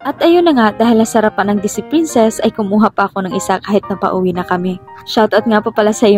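ordinary talk, nothing marked

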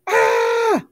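sudden high loud yelp of pain. Acted. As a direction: cry out as the thumb is bitten. Snake bites his thumb.

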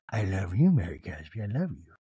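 low, breathy-free murmur of love; Meredith impression. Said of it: "And Meredith goes".

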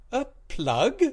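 high loud startled question; a sudden dawning shock; surprised. A narrator's reading. Captain's sudden realisation.

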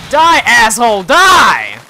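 loud, angry battle cry; furious yell. Real, not acted. Player yelling at a monster in a fight, furious and loud. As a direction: roar it at the monster.

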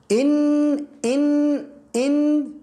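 إن said high and stretched; en sound. Shown as a demonstration. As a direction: repeat إن, high and held. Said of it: "هل تستطيع أن تقرأ".

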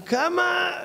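high shocked question; shocked how much. Retold or imitated. Speaker to salesman, shocked by the price. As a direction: squeak out the question in disbelief.

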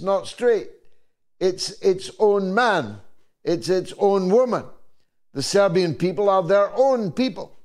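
rising, emphatic political speech in measured phrases; rally the crowd with deliberate, repeated cadences; impassioned oratory. Real, not acted. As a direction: build to a high, ringing declaration. Rousing speech in praise of Serbia.

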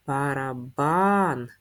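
barely moving through Барабан. syllable by syllable; teacher sounding out a word; slow syllable read. Shown as a demonstration. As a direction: draw Барабан. out very slowly.

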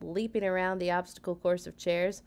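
ordinary delivery, nothing marked

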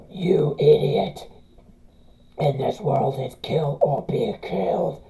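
creepy low whisper, slow; evil Flowey hissing threats; sinister whisper. Acted. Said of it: "that flowery voice".

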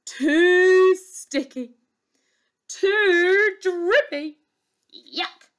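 fussy, high princess complaint; high, picky whining exclamation; exclaimed disgust. Acted. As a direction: reject each one with exaggerated disgust. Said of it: "she exclaimed".

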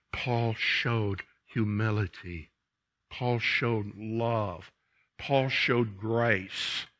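slow, measured, each line starting on Paul; slow solemn litany. Real, not acted. Preacher lists Paul's virtues to the church, slow and solemn. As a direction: let each virtue sink in.

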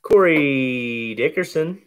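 usual speaking voice

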